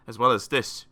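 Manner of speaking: usual speaking voice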